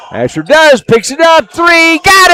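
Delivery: rising yell as the three drops; excited shout. Real, not acted. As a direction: build to a full shout on got it. Call of a made three.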